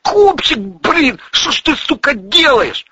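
high, loud wail; pained, whining outcry; howl of pain. A narrator's reading. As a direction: yelp it as if kicked. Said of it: "Юрик буквально взвыл".

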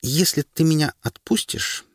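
высоко, с усилием через гордость; выдавить слова через гордость; голос эльфийки. A narrator's reading. (эльфийка, через силу)

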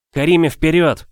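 loud, high barked command; barked order. Acted. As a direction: snap the order out sharply. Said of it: "рявкнула".

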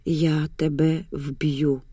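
low, very slow, hushed threat with no force; lost, powerless whisper. A narrator's reading. Mariana threatens her mother, whispering helplessly. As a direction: murmur it slowly, drained and helpless. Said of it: "шепоче розгублено, безсило".

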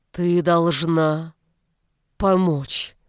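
slow, strained words; labored speech. A narrator's reading. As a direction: force each word out slowly. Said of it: "выговорил он".

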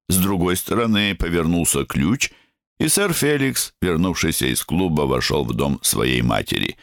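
ordinary delivery

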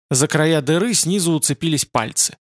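ordinary delivery

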